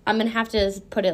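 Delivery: ordinary talk, nothing marked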